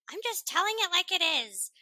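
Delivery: high-pitched quote of a blunt movie mother; brutally honest mother. Acted. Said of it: "she's, like, his Irish mother".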